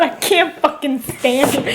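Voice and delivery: ordinary delivery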